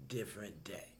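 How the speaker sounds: whispered aside. Real, not acted. Muttered punchline.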